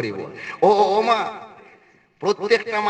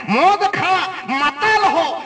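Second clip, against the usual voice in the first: mock command; 7 semitones higher, 4 dB louder, much slower than usual. Mock command in retold scene.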